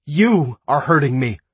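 high, loud cry of pain; pained scream. Acted. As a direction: scream it as he grips her arm. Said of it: "Dre screamed".

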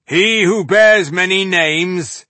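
slow, loud, high proclamation; echoing herald's call. A narrator's reading. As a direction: boom it out slowly across a battlefield. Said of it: "so echoed the voice".

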